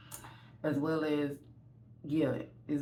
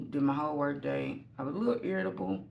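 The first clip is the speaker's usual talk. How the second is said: usual speaking voice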